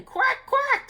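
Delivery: high duck quacks; cow quacking. A narrator's reading. Cow quacks sadly about the meadow. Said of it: "said the cow".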